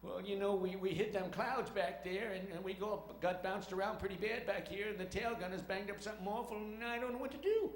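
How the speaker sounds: put-on high, rambling old sidekick voice; Walter Brennan. Sergeant reporting damage to his captain, sidekick impression. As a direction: fret like a folksy western sidekick, rising at the end. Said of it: "Walter Brennan says".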